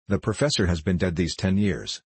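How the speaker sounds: usual speaking voice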